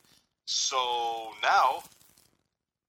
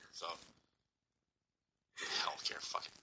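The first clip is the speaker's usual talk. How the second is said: whispered; 7 semitones lower, 8 dB quieter than usual. Real, not acted. Muttered aside after rant.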